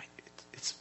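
soft, halting sickbed whisper; Mike's weak whisper. Retold or imitated. Dying friend struggles to answer the pastor, weak whisper. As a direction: whisper it haltingly, short of strength. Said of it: "he talks like this".

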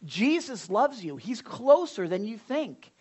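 ordinary talk, nothing marked

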